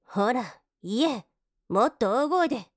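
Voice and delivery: loud, forceful order; sudden loud command, building in force; commanding shout. Acted. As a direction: raise your voice into an impatient demand. Maid orders master to speak louder.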